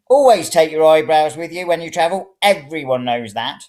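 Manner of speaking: put-on high, loud old lady lecturing; fussy old woman. A narrator's reading. Old woman lectures the stranger about travel, high and bossy. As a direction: lay down the law like a nagging granny.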